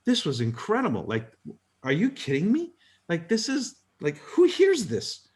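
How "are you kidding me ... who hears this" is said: higher, rising in amazement; amazed disbelief. Real, not acted. Recalling the old man's words, in amazed disbelief. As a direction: let the wonder lift the voice.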